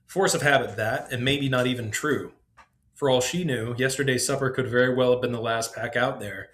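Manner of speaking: ordinary delivery, nothing marked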